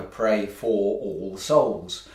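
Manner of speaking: slow, thick, catching throat; pushing words past a throat tickle; frog in throat. Real, not acted. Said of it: "got a frog in my throat".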